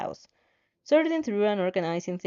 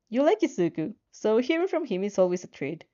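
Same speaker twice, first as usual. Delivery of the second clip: ordinary delivery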